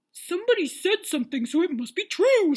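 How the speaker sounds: high, dim-witted certainty; mock gullible alarm. Peter believing gossip instantly, mock gullible. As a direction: declare it high, stretching the last word.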